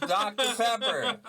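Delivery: high, loud, drawn-out delivery; excited exclamation. Real, not acted. Echoing friend on the drink's taste, loud amused excitement. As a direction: belt it out slowly with a laugh.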